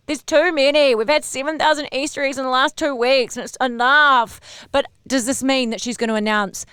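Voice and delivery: ordinary talk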